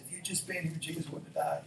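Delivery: mildly acted upset complaint; upset Martha. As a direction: lightly voice a grieving sister's reproach. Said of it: "you know, and all upset".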